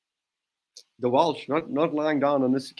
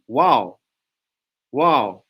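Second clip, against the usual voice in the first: stunned wow; 7 dB louder than usual. Real, not acted. Reacting to the knock-on.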